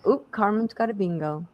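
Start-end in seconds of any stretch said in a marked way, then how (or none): none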